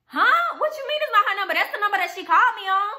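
high, loud startled question; can't believe what he just heard; shocked disbelief. Retold or imitated. [name] stunned by her friend on the phone, high and loud. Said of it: "I'm like".

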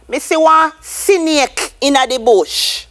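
"snake" said as "sinik", a vowel slipped in after the s; vowel inserted in snake. Shown as a demonstration. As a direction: say "snake" as "si-nik". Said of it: "Look at these now".

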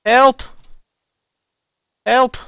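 calling for help. Acted. Fleeing soldier calling out to anyone for help, hammed-up shouting. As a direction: shout for rescue while running.

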